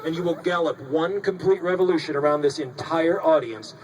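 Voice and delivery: ordinary talk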